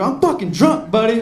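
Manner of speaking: high, loud retold outburst; belligerent drunk. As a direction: yell it like a drunk picking a fight. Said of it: "that's when I got belligerent".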